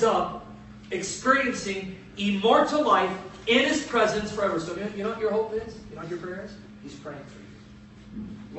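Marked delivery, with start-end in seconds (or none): 5.9-8.6 hushed whisper, much softer. Acted. Preacher confiding to the congregation, whispered. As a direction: lean in and whisper it. Intimate aside to listeners.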